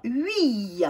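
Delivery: UI said as u gliding into i-y; UY sound. Shown as a demonstration. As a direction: sound out UI from rounded u to i. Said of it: "ça va faire".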